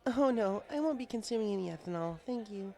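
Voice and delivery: put-on high, bookish young mare voice, caught off guard; Twilight Sparkle. Twilight puzzled the bartender knows her name, high voice. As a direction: pitch it up as a studious unicorn, puzzled.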